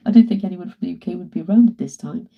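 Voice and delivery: usual speaking voice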